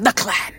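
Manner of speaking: "clan" pointedly emphasized; stress on "clan". Shown as a demonstration. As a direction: lean hard on the word "clan". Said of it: "emphasis on".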